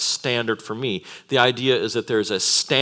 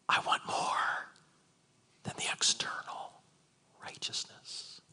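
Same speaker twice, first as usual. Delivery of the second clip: whisper; 12 dB quieter than usual. Acted. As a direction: whisper it as a solemn demand. Christ's words whispered, then asked if heard.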